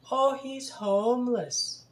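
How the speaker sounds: high, slow mocking imitation; passerby excusing the attacker, high and slow mock voice; mocking bystander voice. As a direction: mimic the bystander as clueless, drawn out.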